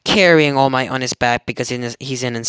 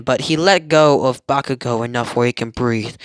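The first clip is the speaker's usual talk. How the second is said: usual speaking voice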